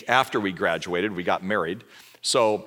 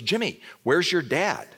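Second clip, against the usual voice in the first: higher, slower call from a hospice bed; agitated mother, slower; 7 semitones higher, slower than usual. Retold or imitated. Dying mother asks her son where his dad is, slow and higher. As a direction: ask for her husband again, drawn out and anxious. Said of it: "kinda agitated".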